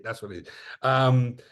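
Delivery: normal talking voice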